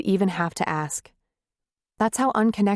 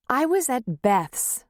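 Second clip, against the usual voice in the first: hot, slow retort pitched higher; hot and loud; 6 semitones higher, slower than usual. A narrator's reading. Wife answers husband's accusation hotly. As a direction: snap back hotly at him. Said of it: "hotly, loudly".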